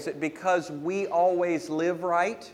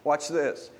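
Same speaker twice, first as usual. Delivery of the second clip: normal talking voice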